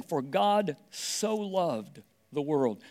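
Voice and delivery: very slow, each word weighed; slow solemn reading. Shown as a demonstration. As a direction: read the verse slowly and reverently. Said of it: "it just starts out by saying".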